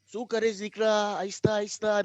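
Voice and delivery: high, slow coaxing of a dog; imitated tender pet talk; talking nicely. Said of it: "start talking to him nicely".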